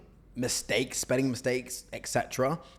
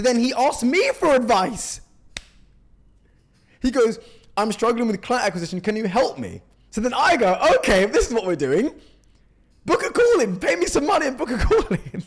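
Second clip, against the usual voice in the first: high, loud, laughing exasperation; excited retelling; 11 semitones higher, 8 dB louder than usual. Real, not acted. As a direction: tell it wound up and laughing in disbelief. Retelling the absurd exchange.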